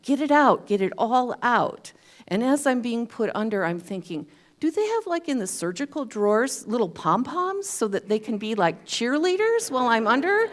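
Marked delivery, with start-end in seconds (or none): 0.0-1.9 higher, louder cheer of get it out; like a cheerleader urging her on; mock pom-pom cheer from the surgical team. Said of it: "I hear this voice saying". 4.6-10.5 giddy wonder. Retold or imitated. Drugged wondering.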